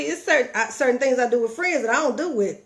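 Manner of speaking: ordinary talk, nothing marked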